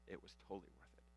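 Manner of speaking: breathless whisper, nearly gone. Retold or imitated. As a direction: whisper it like a fond secret. Parents recalling childbirth, voice drops.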